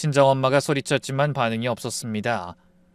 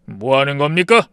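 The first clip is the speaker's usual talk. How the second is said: sharp voice; 4 dB louder than usual. Acted. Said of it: "친정아빠의 날카로운 목소리".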